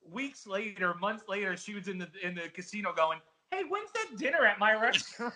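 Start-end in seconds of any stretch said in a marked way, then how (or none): 3.5-5.3 higher-pitched demanding customer call; indignant customer. Acted. Booked player asks casino about the promised dinner, indignant. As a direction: act out a diner chasing what was promised.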